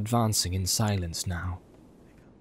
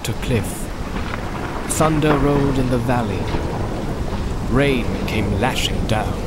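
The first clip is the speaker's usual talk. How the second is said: louder, driving battle narration; dramatic narration; 4 dB louder than usual. A narrator's reading. Narrator describes the assault, rising dramatic intensity. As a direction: tell the storm and assault with force.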